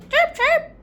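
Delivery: very high, loud bird chirps; robin chirping. Acted. Robin answering the girl's plea, high chirping. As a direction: squeak it out like a little bird.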